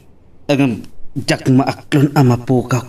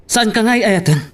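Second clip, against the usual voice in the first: high, tearful plea; pleading lover, crying; 7 semitones higher than usual. Acted. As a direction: ask it through tears, voice pitched up. Tearful quarrel over marriage.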